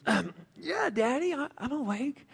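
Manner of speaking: put-on a kid answering from under the covers; boy answering his father softly from bed; sleepy little boy. A narrator's reading.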